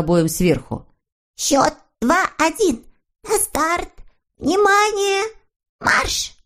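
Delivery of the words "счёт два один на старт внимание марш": high-pitched referee calling the start; excited little referee. A narrator's reading. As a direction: call the start in a high, piping voice. (высокий голос судьи, командующего стартом)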